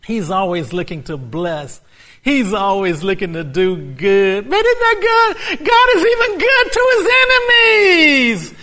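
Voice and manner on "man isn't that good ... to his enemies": high, loud preacher's cry; preaching climax. Real, not acted. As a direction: lift it up with fervor.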